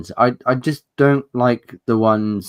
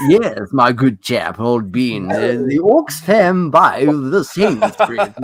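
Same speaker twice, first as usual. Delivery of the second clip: mock posh British; 4 dB louder, slower than usual. Posh British role play.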